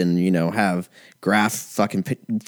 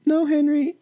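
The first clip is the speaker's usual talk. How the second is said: high, rising mock telenovela pleading; soap opera melodrama; much slower than usual. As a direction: ham up a soap opera hunk begging. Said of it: "jack dudes just going".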